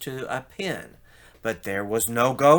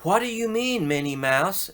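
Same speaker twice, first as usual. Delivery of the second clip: high surprised what; lightly voiced puzzled Daisy; 7 semitones higher than usual. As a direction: ask startled. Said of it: "Daisy Duck asked".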